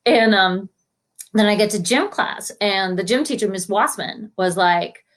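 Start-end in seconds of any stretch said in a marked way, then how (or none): none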